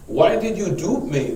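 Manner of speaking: scripture read aloud as a wronged man's complaint; lightly voiced indignant Laban. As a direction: read as a mildly aggrieved father-in-law demanding an answer. Reads Laban's reproach aloud.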